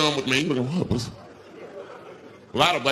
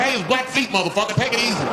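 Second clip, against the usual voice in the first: higher, agitated correction; indignant protest; 9 semitones higher than usual. Retold or imitated. Corrects the chief after the splash, agitated and high. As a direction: snap back at the chief.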